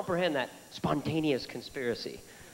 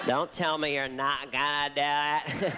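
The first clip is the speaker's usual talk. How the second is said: slow, drawn-out teasing dare; lightly voiced president, slowed down; a slow mock challenge; slower than usual. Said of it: "You know".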